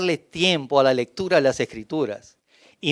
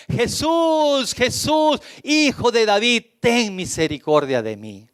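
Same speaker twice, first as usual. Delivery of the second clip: high desperate cry of a blind beggar; clamor del ciego; 8 semitones higher, slower than usual. Acted. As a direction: cry out to Jesus across the crowd. Ciego clamando a Jesús. (grito desesperado del ciego)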